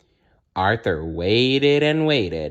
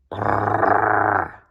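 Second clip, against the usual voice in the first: dog growl. Acted. Perky growling at Arthur, dog growl. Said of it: "growled Perky".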